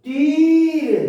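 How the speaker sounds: loud, high dictation of "तीर"; dictation call. Shown as a demonstration. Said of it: "तो जैसे कोई कह दिया हमें".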